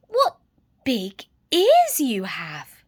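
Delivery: slow, loud and wide-eyed; slow wondering. Acted. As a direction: stretch it out in wonder. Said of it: "she said slowly".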